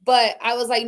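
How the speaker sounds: ordinary delivery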